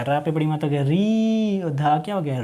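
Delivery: normal talking voice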